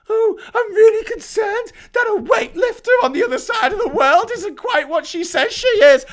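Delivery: high, loud mock wail; shrieky parody of a worrier; mocking concern. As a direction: whine it in a high, hammed-up voice. Mocking culture-war worriers' complaint.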